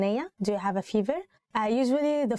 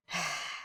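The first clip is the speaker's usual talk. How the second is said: a long weary sigh; tired sigh; 5 semitones lower, 10 dB quieter than usual. Real, not acted. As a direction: sigh out, worn out. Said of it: "I think that's enough".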